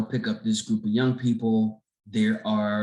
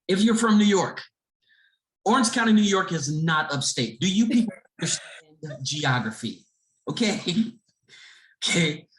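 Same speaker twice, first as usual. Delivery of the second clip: exasperated rant; 8 semitones higher than usual. Real, not acted. To New Yorkers about upstate, exasperated rant. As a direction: lecture them with mock exasperation. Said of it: "give my sidebar".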